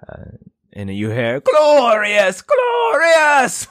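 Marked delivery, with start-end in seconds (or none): 1.4-3.7 booming exultant shout. Acted. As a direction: bellow it like a jolly king. King shouting offstage.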